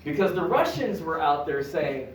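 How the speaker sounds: ordinary delivery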